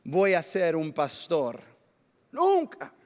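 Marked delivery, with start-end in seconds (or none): 2.3-2.9 high, loud, punched NUNCA; emphatic never. Retold or imitated. Pastor retelling his vow, loud emphatic never. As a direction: slam the word down like a vow.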